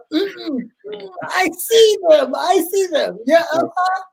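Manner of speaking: high, whiny scared refusal; pleading to stay back from the casket. Retold or imitated. Boy refusing his mother at the funeral, high and scared. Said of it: "And she'd be".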